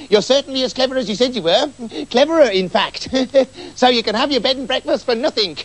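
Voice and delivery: high-pitched fawning praise with laughs; butter them up, chuckling; a high innkeeper voice laughing through false flattery. Acted.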